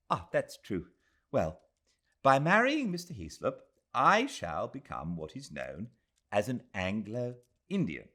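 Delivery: put-on a young woman confiding her news; young Englishwoman. Adela telling Aziz of her engagement, earnest.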